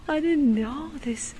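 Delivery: hushed, breathless whisper of wonder; awed whisper. Real, not acted. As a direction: whisper it in amazement. Awed at church interior, hushed.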